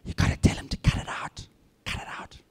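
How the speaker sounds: urgent whispered instruction. Retold or imitated. Colleague urging me in a whisper to stop him.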